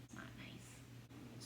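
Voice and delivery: slow, breathy whisper under the breath; whispered aside. Pretend. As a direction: let it slip out as a secret. Aside about the judges' slap.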